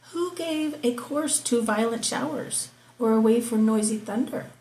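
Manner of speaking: measured, declaiming Bible reading; scripture reading. Acted. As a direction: read it out like from the pulpit. Reads Job 38 aloud.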